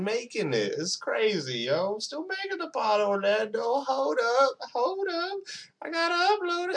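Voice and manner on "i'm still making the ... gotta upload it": much higher playful squeak; jokey high-pitched aside to a friend; squeaky falsetto. Pretend. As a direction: toss it off in a mock high voice. Joking aside to Orlando.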